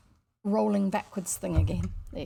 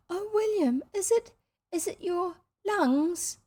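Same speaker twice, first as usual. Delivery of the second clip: put-on alarmed little girl; 10 semitones higher than usual. A narrator's reading. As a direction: gasp it out, fearful. Girl alarmed, voiced high.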